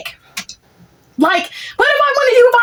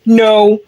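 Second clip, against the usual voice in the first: no dropped low and loud; a hard, final no; emphatic no; 9 semitones lower, 4 dB louder than usual. Real, not acted. Emphatic repeated flat refusal.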